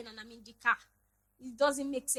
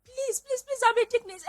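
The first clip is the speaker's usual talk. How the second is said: mother's higher, pleading voice; imitating mom pleading; 11 semitones higher, 6 dB louder than usual. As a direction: plead like a mom insisting she's innocent. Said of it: "That was how my mommy did".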